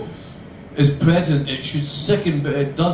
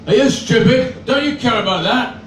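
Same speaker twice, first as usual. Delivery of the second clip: loud, high indignant scolding; outraged official; 7 semitones higher, 3 dB louder than usual. Acted. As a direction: tell him off like a furious transport worker. Official scolds him for smoking.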